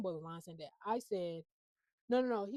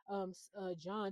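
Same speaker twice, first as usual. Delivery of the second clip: usual speaking voice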